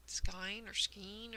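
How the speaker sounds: skein said first as "skine", then as "skeen"; two wrong tries at skein, "skine or skeen"; vowel of skein changed to long i, then long e; mispronounced skein. Retold or imitated. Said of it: "when people say skein or skein".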